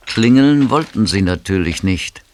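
ordinary talk, nothing marked

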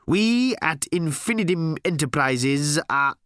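high, slow corporate proclamation; pompous, drawn-out executive speech; grand announcement. Acted. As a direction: proclaim the company name proudly.

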